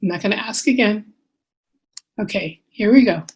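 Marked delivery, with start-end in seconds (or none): none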